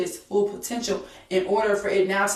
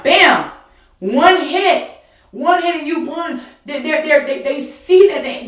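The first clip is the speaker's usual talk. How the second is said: loud, high preacher's punch; fiery preaching; 8 semitones higher, 9 dB louder than usual. Acted. Preacher shouting the knockout to the congregation, loud and high. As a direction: hit it hard like a knockout blow.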